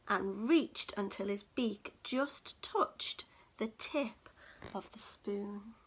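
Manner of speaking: usual speaking voice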